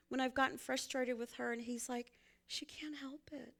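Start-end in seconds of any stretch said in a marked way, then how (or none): 2.5-3.5 whispered. Retold or imitated. Son's gentle reply retold.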